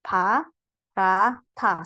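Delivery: "Pa, ra, ta" split with pauses; syllable by syllable. Shown as a demonstration. Teacher splitting syllables for the student, slow and clear. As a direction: break it into "Pa, ra, ta". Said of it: "pemisahannya seperti ini".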